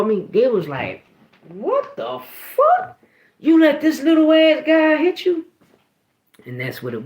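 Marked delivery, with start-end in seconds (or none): 1.5-5.5 high, loud mock yell; high-pitched, incredulous scolding impression; outraged parents. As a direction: imitate parents shrieking in disbelief, the first words drawn out. Angry parents confronting kids, retold.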